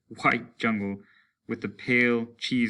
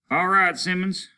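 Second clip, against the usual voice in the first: a lieutenant's raised command; voiced order; 9 semitones higher, 6 dB louder than usual. Lieutenant calls to Simmons, a raised command. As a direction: call it out to move the men.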